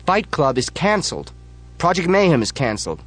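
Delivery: high, loud insistent cry; shouted cancel. Acted. Narrator shouts over the chapter leader, loud. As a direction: shout down the rules.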